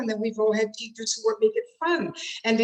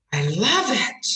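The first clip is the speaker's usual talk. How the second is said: adore it, stretching "love"; demonstrated delighted emphasis; gushing love; 4 dB louder than usual. Shown as a demonstration. Demonstrates love versus hate.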